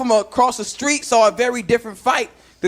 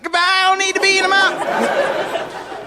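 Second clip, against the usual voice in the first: dramatic farewell; 8 semitones higher, slower than usual. Pretend. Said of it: "It's like".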